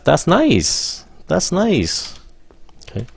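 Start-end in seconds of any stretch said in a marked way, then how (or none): 0.0-1.9 be happy for them; high, pleased. Shown as a demonstration. Teacher showing students a cheerful, high That's nice. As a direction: pitch lifted high on That's nice, warm praise. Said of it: "Lên giọng".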